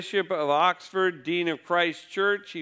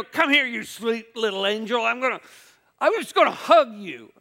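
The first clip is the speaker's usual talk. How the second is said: higher and louder, gruff on sweet words; 6 semitones higher, 4 dB louder than usual. Shown as a demonstration. Preacher showing a gruff tone on loving words to a child. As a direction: bark the tender words roughly. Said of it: "if you use a gruff tone of voice".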